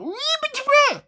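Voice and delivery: sudden high-pitched approval; excited praise, pitch leaping up; high cheer. Real, not acted. Cheering the New York detail.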